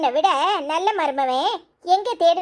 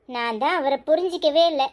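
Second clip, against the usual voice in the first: ordinary talk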